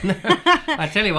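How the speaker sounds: a high laughing no that tumbles into words. Real, not acted. Host laughing at his wife's answer, a high squeak.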